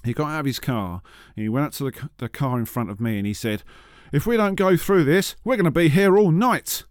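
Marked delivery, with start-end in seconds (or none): 4.1-6.8 higher, louder imitation of alarm; mock urgent driver. Guy warning the car in front at the flood, mock urgent. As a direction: raise pitch and volume like an impatient motorist. Acts out foolish driver in traffic.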